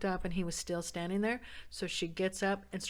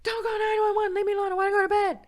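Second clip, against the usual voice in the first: high loud refusing yell; angry shout; 9 dB louder than usual. Retold or imitated. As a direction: shout back, leave me alone. Husband refusing in a quarrel.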